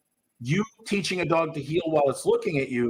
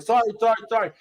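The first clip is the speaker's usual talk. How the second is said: higher, louder pleading sorry; apologetic puppy; 6 semitones higher, 5 dB louder, much faster than usual. Retold or imitated. Trainer voicing a cringing puppy begging forgiveness, higher and louder. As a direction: grovel like a corrected puppy. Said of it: "They're like".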